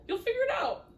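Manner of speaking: high teasing lilt; excited. Real, not acted. Teasing after a laugh.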